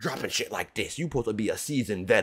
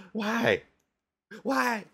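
a pitched-up, laughing why; high disbelieving why; 10 semitones higher, 4 dB louder than usual. Real, not acted. A fan asking [name] why, high and incredulous.

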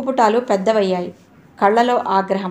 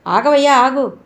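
raised, louder call to stop; urgent higher-pitched call, short of a scream; 4 semitones higher, 4 dB louder than usual. A narrator's reading. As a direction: call after someone walking away. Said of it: "ఆయన కేకపెట్టి పిలిచారు".

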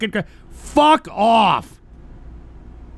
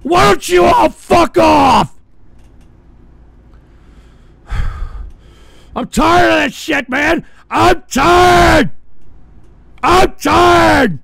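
louder, higher, slower shouting; furious shout; 5 semitones higher, 9 dB louder, slower than usual. Real, not acted. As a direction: yell it out, completely fed up. Screaming at trolls, exhausted.

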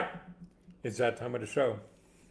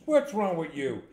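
higher, louder bullying challenge; mean big guy; 6 semitones higher, 9 dB louder than usual. Acted. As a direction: confront him like a bar bully. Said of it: "mean looking guy".

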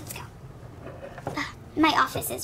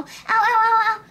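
mock yelps of pain; 6 semitones higher, 4 dB louder than usual. Child cries out at wipe.

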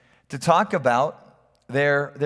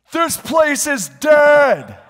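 high, drawn-out shout imitating deaf grandpa; 11 semitones higher, 8 dB louder, much slower than usual. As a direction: blurt it slowly at full volume. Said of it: "Really out, out loud".

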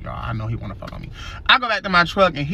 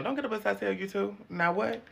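a no-nonsense ISS teacher scolding students; sassy detention teacher; 3 semitones higher than usual. Acted. In-school suspension teacher scolding students, sassy and tired. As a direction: run detention like you've seen it all.

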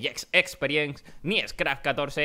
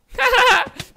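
burst of laughter; 8 dB louder than usual. Real, not acted. A sudden fall while gaming.

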